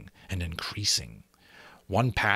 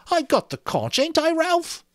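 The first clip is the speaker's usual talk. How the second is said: high, pleading appeal; pleading Piggy; 4 dB louder than usual. A narrator's reading. Piggy to Ralph, pleading. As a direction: beg Ralph for support.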